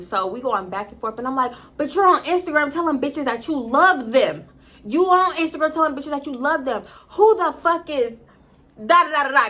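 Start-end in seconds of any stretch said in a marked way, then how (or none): 1.8-9.5 raised, louder confrontation; retold angry accusation. Confronting boyfriend over telling women he loves them, angry. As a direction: call him out, pitch climbing.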